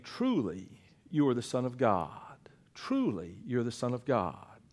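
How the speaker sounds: soft, low whisper of worship; whispered confession of faith; hushed awe. Acted. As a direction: breathe it in reverent awe.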